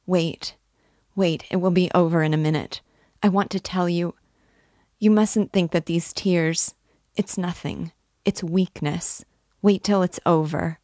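usual speaking voice

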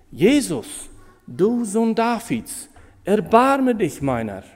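blind beggar calling out high and loud, drawn out; crying out. Retold or imitated. Blind Bartimäus cries out to Jesus for mercy, loud and high. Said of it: "dann fängt er an zu schreien".

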